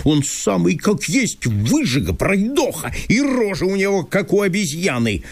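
high, agitated landowner in disbelief; Chertopkhanov voice. Acted. Landowner reproaching Masha for leaving, high and fretful.

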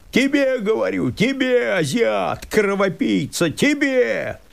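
высоко, надрывно, с ненавистью; кричи, как обезумевший от отчаяния; яростный крик. Acted. Мужик кричит на лесника. (мужик в ярости обвиняет лесника)

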